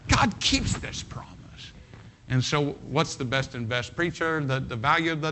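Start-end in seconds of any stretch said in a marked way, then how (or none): 0.1-1.3 high breathy whisper of the promise; hushed whispered emphasis. Shown as a demonstration. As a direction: whisper it like a secret. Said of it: "repeated for emphasis".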